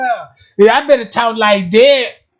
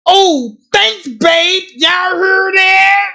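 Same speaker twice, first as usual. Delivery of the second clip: higher, slow and showy: Oh, thanks, babe; mock host calling out; 10 semitones higher, much slower than usual. Mocking the séance host, hammed-up and showy. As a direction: ham up the thrilled host. Acting out Candy Star's séance.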